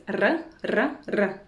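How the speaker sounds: R as a short trilled "rr" sound; rolled Russian R. Shown as a demonstration. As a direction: roll each R on the tongue tip. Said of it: "try pronouncing this letter like this".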